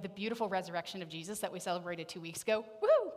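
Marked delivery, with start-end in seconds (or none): 2.8-3.1 cheer. Real, not acted. Celebrating the resurrection, cheering.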